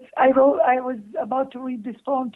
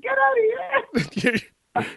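slow, high mock-angry yell; half-laughing fake anger at husband; 12 semitones higher, slower than usual. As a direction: stretch it out in pretend rage. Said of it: "I was like, very angry".

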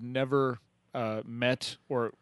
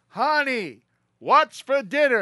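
a much higher, louder, slow call right at the kitchen; loudest call; 13 semitones higher, 9 dB louder, slower than usual. Retold or imitated. Husband at the kitchen door calls his wife as loud as he can. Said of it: "gets right next to the kitchen and goes".

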